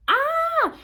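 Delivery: high, loud held "ah"; sudden high scream, acted out; re-enacted pain scream. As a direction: cry out as if a contraction hit. Acting out contraction pain.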